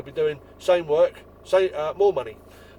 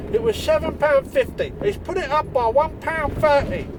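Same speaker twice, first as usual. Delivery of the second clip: customer's voice; 10 semitones higher, 4 dB louder than usual. Retold or imitated. Quoting the customer woman.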